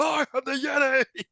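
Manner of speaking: high, drawn-out triumphant cry; slow, high, hammed-up roar; mock monster boast. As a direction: proclaim it as a rowdy dude. Dude's boast yelled, acted out.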